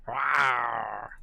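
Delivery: low mock roar; spoken-style gargoyle "rawr"; monster roar. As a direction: roar playfully, low and drawn out. Gargoyle attack noise.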